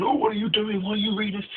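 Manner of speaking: high-pitched mock outraged yell; high, indignant retold shout without much volume; imitated shouting. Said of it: "she's, like, shouting".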